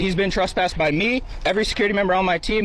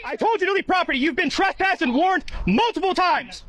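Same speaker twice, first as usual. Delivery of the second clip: higher, harder repeated command; 9 semitones higher than usual. Real, not acted. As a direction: demand it, losing patience. Guard repeats order, louder.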